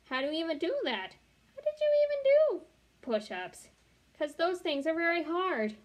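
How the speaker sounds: very high, fast amazed question. A narrator's reading. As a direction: ask in disbelief.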